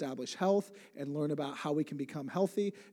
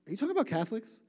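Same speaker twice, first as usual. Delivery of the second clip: higher-pitched, puzzled disbelief; incredulous question; 7 semitones higher, 3 dB louder, much faster than usual. Retold or imitated. Re-enacting his question to the Russian, incredulous. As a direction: ask it as a mock-baffled check.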